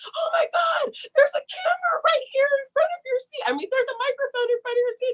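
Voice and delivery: high, fast excited chatter; breathless thrill about the seats; excited friends. Retold or imitated. Friends thrilled about mics at their seats, high excited chatter.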